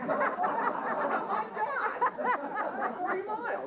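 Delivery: high, drawn-out laughing outcry; laughing disbelief. Real, not acted. Son recalls being told to walk home forty miles, laughing.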